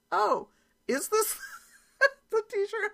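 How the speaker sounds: high, loud, slow imitation of a daughter catching on; drawn-out mock surprise; dawning realization. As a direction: mimic sudden realization, breaking into laughter. Daughter realizing, quoted through laughter.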